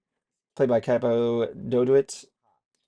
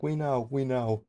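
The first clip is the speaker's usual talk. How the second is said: soft, slow mock resignation; lone crowd member; 7 dB quieter, slower than usual. One person in the crowd answering, softly imitated. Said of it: "one person going like".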